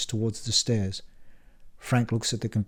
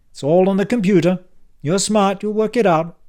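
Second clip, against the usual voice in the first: put-on louder, higher, impatient old man; brusque uncle Frank; 9 semitones higher, 7 dB louder than usual. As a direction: bark it like a testy uncle back from the dead. Frank reassures.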